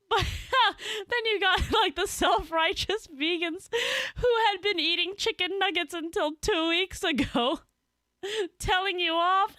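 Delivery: very high squeal through laughter; laughing hard. Real, not acted. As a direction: barely get it out for laughing. Cracking up over self-righteous vegans.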